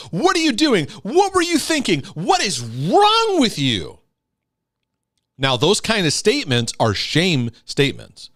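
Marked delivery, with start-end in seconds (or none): 0.1-4.0 put-on high, sharp scolding of a child; a parent snapping at their child, high and sharp. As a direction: snap at the kid like an exasperated parent. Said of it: "You snap at your kids and go".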